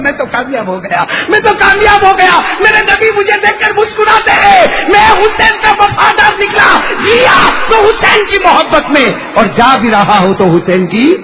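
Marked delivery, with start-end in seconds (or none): 1.3-2.4 much higher, louder shouted cry; high, loud victorious call; triumphant shout. Acted. Repeated line, shouted take. 2.6-11.2 high, loud, slow preaching cry; impassioned sermon shout; full-force preaching. Acted.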